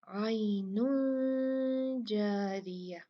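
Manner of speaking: आइनुन जारिया very slowly with a hidden nasal न; ikhfa recitation. Shown as a demonstration. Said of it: "तो हमने यहाँ पर". (आइनुन जारिया, धीमा, छुपा हुआ न)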